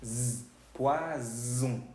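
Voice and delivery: slow "z, poisson", a zzz then pwaa-zzon; buzzed Z sound. Shown as a demonstration. Teacher breaks down the word for poison, long voiced Z. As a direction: stretch "poisson" and buzz its middle as Z. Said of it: "se pronuncia como una Z".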